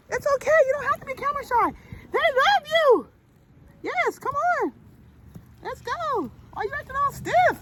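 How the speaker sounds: high coaxing voice to the chicken. Real, not acted. Owner coaxing a camera-shy chicken in high baby talk.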